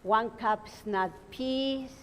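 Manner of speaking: normal talking voice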